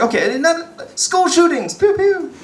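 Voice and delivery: high, chirpy gun joke; mock cheerful. Comic listing school shootings in a high cheery voice. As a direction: chirp it brightly despite the subject. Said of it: "so I was like".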